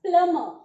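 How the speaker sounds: plumber said loud as plum-er, b silent; correct pronunciation. Shown as a demonstration. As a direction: plumber spoken with no b sound. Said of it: "The correct pronunciation is". (प्लमर, बी चुप, ज़ोर से)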